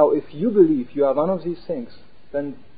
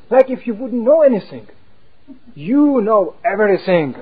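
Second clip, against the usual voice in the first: indignant false ego; 4 semitones higher, 6 dB louder than usual. Retold or imitated. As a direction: sneer like a proud ego. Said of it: "there is a false ego going".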